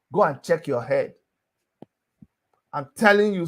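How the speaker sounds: ordinary delivery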